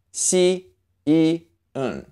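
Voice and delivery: "Xi, yi, en" as separate sounds; syllable breakdown. Shown as a demonstration. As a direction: sound out "Xi, yi, en" piece by piece. Teacher sounds out syllable parts.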